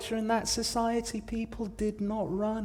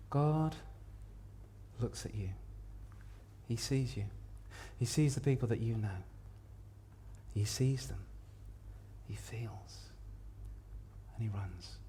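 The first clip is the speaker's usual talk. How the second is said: hushed reverence; 8 semitones lower, 11 dB quieter than usual. Acted. Preacher telling listeners that God sees them, hushed. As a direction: drop low and let each phrase sink in. Hushed turn to God's compassion.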